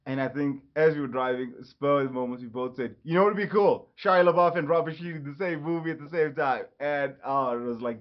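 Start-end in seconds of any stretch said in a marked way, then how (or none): 3.0-6.7 louder, higher, eager retold exclamation; excited shared idea. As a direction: blurt out the bright idea, retelling it. Quoting brothers' shared sudden idea.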